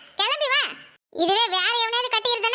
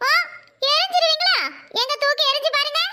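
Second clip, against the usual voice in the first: defiant challenge; 4 semitones higher than usual. Acted. Mother-in-law challenges angrily.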